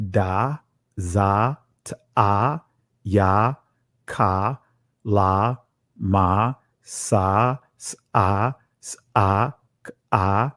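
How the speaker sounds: slow, low syllable-by-syllable recital of "A, ba, ga, da"; each syllable "ba", "ga", "da" read alone, low, with a pause after it; reading out the Ge'ez syllables. Shown as a demonstration. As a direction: sound out each letter "ba", "ga" like a teacher at the board. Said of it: "I'm gonna read to you".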